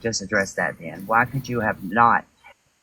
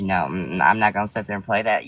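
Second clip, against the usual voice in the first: ordinary delivery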